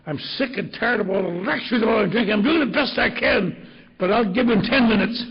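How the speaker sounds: loud, high-pitched angry rant; exasperated outburst. Retold or imitated. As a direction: act a husband loudly fed up with lectures. Retold husband's angry outburst.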